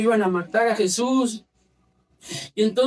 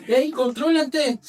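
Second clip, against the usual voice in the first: normal talking voice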